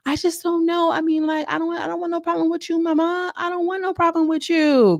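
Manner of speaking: small, gentle voice that wants no trouble; reluctant fighter pleading to avoid conflict, high and soft; soft, light voice. Retold or imitated. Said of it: "Very, very soft, very light".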